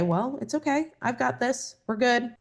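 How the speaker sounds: calm a frightened part of yourself; gently telling the energy all is well; reassuring. Pretend. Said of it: "And you can say".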